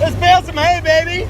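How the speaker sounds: high, loud pumped-up cheer; excited cheer. Real, not acted. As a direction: shout it out, fired up. Cheering as he drives off.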